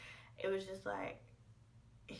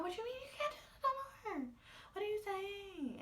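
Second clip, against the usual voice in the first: very high, fast disbelieving cry; shocked disbelief; 13 semitones higher, faster than usual. Retold or imitated. Girlfriend hearing her boyfriend end it, very high stunned disbelief. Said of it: "I'm like".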